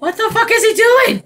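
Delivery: high, loud alarmed yell; freaked-out cry at the chaser; startled. Real, not acted. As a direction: shriek in disbelief. Startled by a stalker.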